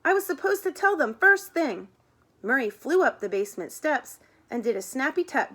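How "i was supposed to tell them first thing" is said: high, urgent exclamation; worked-up high confession; crying out. A narrator's reading. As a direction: blurt it out in a raised pitch. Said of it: "cried Murray".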